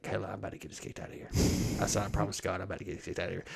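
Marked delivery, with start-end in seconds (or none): 0.0-1.3 fast, much softer aside to his wife; hushed whisper back. Retold or imitated. 2.0-3.4 hushed aside. Retold or imitated. Whispering to wife.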